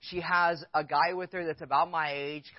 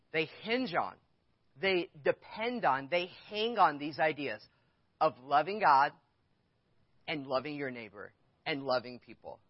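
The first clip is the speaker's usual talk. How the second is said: ordinary delivery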